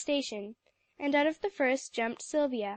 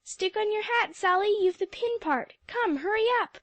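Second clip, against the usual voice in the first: higher, louder hurried urging; urgent cry; 9 semitones higher, 4 dB louder than usual. A narrator's reading. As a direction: rush a friend onto the train. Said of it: "cried the others".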